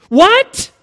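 high, loud, startled yelp; comic high-pitched outburst; crying out in disbelief. Acted. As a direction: blurt it in shocked disbelief. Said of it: "cried the man".

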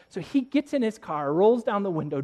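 ordinary talk, nothing marked

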